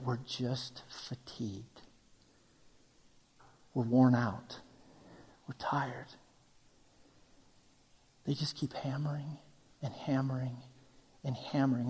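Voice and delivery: very soft, low, worn-out delivery; weary. Acted. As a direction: let the voice sink as if drained. Describing worn-out people under trials.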